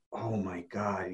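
soft, low, slow disbelief; hushed amazement. Retold or imitated. Coach retells client's reaction, hushed and overwhelmed. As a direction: imitate someone quietly overwhelmed.

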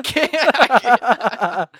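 loud, slow, choking on laughter; too breathless from laughing to get words out. Real, not acted. Host helpless with laughter at ending the episode.

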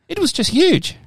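high, hammed-up quote from the gardener's wife; Donna. Podcaster quoting wife about giant potato, high mock voice. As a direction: put on a very high comic voice. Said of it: "Oh, Donna said".

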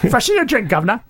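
hammed-up British catchphrase; attempted British accent. Shown as a demonstration. Co-host shows the few phrases he can manage, attempted British accent. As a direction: have a rough go at a British accent. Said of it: "certain phrases with a British accent".

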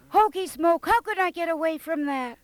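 high bright cartoon character voice. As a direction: squeak it out like a cartoon hero. Voice actress drops a character catchphrase.

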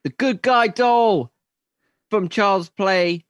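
slow, high and loud announcer voice; booming movie trailer narration; dramatic trailer voice. Acted.

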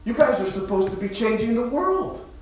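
rally them to change the world; impassioned. Real, not acted. Pastor urging the congregation to grow up, impassioned.

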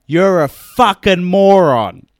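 small dad shouting up at the big player's chest; furious yelled insult. Retold or imitated. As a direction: bellow it without backing down. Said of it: "And my dad just goes".

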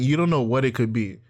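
ordinary talk

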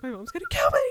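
laughing fake dread; laughing mock panic. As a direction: play the panic through laughter.

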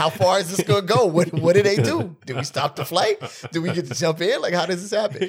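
put-on a hyped-up young guy wondering if he gets to jump in; eager excitement. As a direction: pepper them with excited questions. Retelling his eager thoughts on plane.

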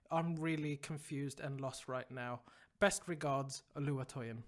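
low, subdued letter-reading voice; reading email aloud. A narrator's reading. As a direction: read the listener's problem out evenly. Reads listener email aloud.

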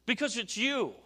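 high, emphatic preacher with long pauses; impassioned preaching. Real, not acted. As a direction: drive the point home to the congregation. Preaches the point at full force.

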